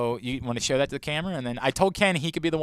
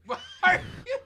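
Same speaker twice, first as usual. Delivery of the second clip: laughing shout; faster than usual. Real, not acted. Laughing disbelief at suggestion.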